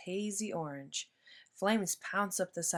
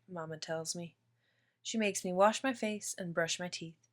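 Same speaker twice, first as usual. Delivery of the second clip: plain reading voice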